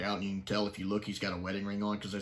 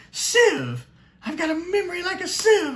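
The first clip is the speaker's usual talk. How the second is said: put-on very high, loud exclamation of a scatterbrained old man; the forgetful professor; 9 dB louder than usual. Reviewer quoting the forgetful professor, very high and loud. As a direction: cry out about your terrible memory as the dotty professor. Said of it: "I absolutely love the professor".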